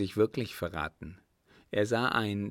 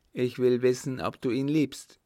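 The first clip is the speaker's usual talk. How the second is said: louder, higher insistent countess; acted angry aunt, voice raised; stern demand; 4 semitones higher, 6 dB louder than usual. A narrator's reading. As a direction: press sternly for an answer. Angry aunt repeats her demand.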